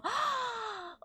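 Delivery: sharp, high gasp of delight. Real, not acted. Streamer thrilled by a viewer's baby Tenno, delighted gasp. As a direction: catch your breath, thrilled.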